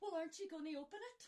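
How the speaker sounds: fast, high, eager squeal; high-pitched rushed eagerness; excited sister. Acted. Excited sisters get royal letter.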